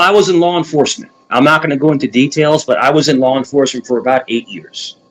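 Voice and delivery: ordinary delivery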